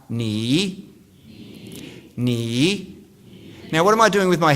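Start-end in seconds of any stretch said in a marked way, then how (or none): 0.1-0.9 "Ni" dipping down, then rising up; "Ni" with a falling-rising Mandarin tone. Shown as a demonstration. Speaker teaching the audience Mandarin, "Ni" dipping then rising. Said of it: "So you're gonna go down and up". 2.2-3.0 "Ni" dipping down, then rising up; "Ni" with a falling-rising Mandarin tone. Shown as a demonstration. Speaker teaching the audience Mandarin, "Ni" dipping then rising. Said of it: "So you're gonna go down and up".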